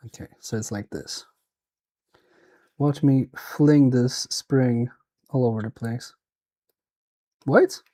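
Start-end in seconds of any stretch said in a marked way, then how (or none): none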